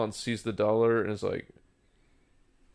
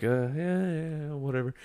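higher, softer offhand brush-off; dismissive reply; 4 semitones higher, 4 dB quieter than usual. Retold or imitated. Man retelling his chat with a stripper, offhand. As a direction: murmur it higher, half-interested.

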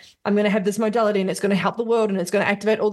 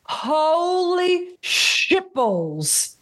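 excited disbelief; 9 semitones higher, slower than usual. Retold or imitated. Retelling her own excited reaction.

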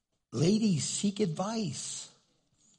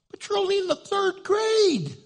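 shocked disbelief; 5 dB louder than usual. Retold or imitated. Shocked parent to grandchild.

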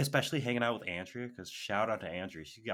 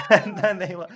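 breaking into laughter mid-sentence; laughing; 10 semitones higher, 16 dB louder than usual. Real, not acted. Laughing to a friend as she recalls a rehearsal, laughing. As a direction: laugh through the words, loud and high. Laughing while starting the story.

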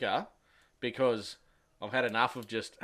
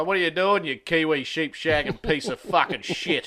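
louder, higher, drawn-out jokey abuse; mock insult; 5 semitones higher, 6 dB louder, slower than usual. Greeting his Kiwi mate on the phone with a loud mock insult. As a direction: hurl the insult at a mate, playfully. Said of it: "And I go".

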